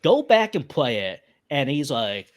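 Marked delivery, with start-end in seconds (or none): none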